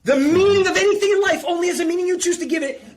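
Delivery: re-read it as a thrilling revelation, bursting with energy; excited realization. Shown as a demonstration.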